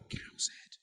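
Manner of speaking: hushed, breathy title reveal; spooky whisper. Acted. Revealing the scary story's title to the co-host, spooky whisper. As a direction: whisper it like a dark secret. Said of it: "I can't hear you".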